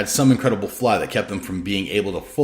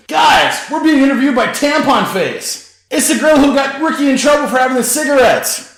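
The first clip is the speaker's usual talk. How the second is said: much higher, louder jeering shout; yelled announcement; 8 dB louder than usual. A narrator's reading. Hendrix shouts to the band behind him, much higher and louder. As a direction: call it out across the room. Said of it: "Hendrix yelled out to the musicians".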